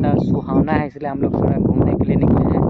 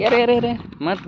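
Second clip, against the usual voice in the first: higher-pitched, startled exclamation; alarmed warning; 10 semitones higher than usual. Real, not acted. Vlogger cautions a companion not to fall, alarmed. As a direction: blurt out a sudden warning. Warns companion about falling.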